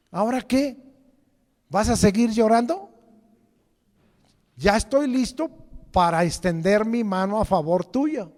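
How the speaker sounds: high, loud voice of the Lord, pressing and insistent; the Lord, urgent. Acted. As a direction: boom out the Lord saying stop the tears, I'm here. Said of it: "Otra, voy a cambiarla".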